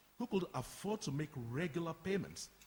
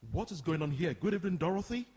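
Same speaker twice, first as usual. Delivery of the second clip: loud, raised voice on walking in; loud entrance greeting; 8 dB louder, faster than usual. Acted. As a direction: call out to the whole room as you enter. Carl walks in, greets the room.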